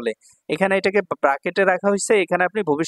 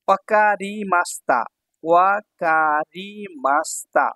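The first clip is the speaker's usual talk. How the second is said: ওয়াকারিমাস্তা slowly, stretched ever further; syllable-by-syllable; much slower than usual. Shown as a demonstration. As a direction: draw out each syllable of ওয়াকারিমাস্তা. Teacher sounds out new phrase.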